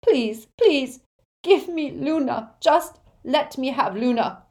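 high, loud, broken begging; anguished father pleading, high-pitched; sobbing plea. A narrator's reading. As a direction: beg desperately for your child. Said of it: "sobbed Xenophilius".

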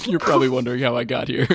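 quick tongue-in-cheek voice-over, on the edge of laughter; mock movie voice-over. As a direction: parody a film's opening narration. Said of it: "Record scratch".

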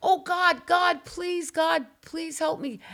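panicked shout that fades into pleading; a retold nightmare scream turning into a desperate prayer; terrified cry to God. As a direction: scream no, then beg God for help. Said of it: "And I just went".

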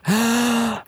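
a sharp, high gasp of recognition; surprised gasp. Retold or imitated. Woman recognizing the host at an event, gasping in surprise. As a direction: gasp as if spotting a celebrity. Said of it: "Peggy Normandin looks at me and goes".

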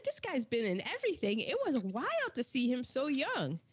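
normal talking voice

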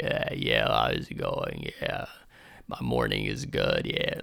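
very low croaky voice, quiet; wrecked voice. Retold or imitated. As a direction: imitate a blown-out, hoarse voice. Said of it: "talking like".

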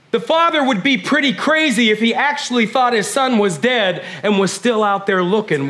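normal talking voice

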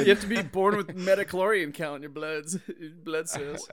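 laughing. Real, not acted. Mocking reply through laughter.

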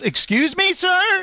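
high, indignant query; affronted performer. Acted. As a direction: question a heckler, pitch climbing at the end. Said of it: "And he goes".

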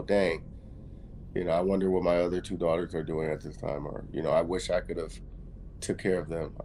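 imagined wistful musing. Retold or imitated. Imagined regretful thoughts of mother.

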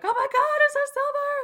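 shrill, frantic high squeal; frantic craving. Retold or imitated. Dragons hearing of silver, frantic high squeal. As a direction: squeal like an addict spotting a fix.

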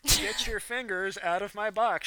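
mock her scolding line slowly; mocking imitation. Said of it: "she said".

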